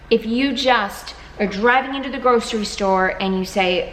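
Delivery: ordinary talk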